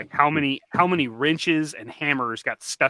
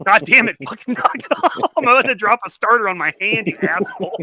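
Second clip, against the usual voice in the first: mock angry shout, higher and louder; frustrated yell; 6 semitones higher, 6 dB louder than usual. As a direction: yell at dad to quit poking, half laughing. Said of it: "I'm like".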